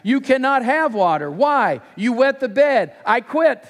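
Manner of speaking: high, forceful back-and-forth; bickering. Acted. Dad and daughter squabbling over water, high exasperated bickering. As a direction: argue it out like dad and small child. Acted father and daughter quarrel.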